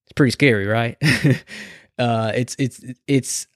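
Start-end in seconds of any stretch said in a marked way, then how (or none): 1.0-1.6 short chuckle at something sad; rueful laugh. Real, not acted. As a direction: let out a brief, rueful laugh. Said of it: "I laugh at it now".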